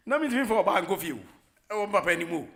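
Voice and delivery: loud, fast, laughing it out. Real, not acted. Host laughing loudly to listeners while retelling a story. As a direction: burst out laughing mid-sentence.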